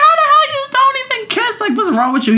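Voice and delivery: very high, quick, disbelieving retort; incredulous outburst. Retold or imitated. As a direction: squeal it in exaggerated disbelief. Retelling disbelief at no kissing.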